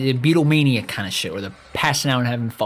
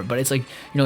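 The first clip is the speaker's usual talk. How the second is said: usual speaking voice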